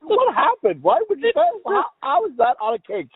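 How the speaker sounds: high-pitched incredulous questioning; baffled disbelief. Real, not acted. Baffled reaction to the cake message.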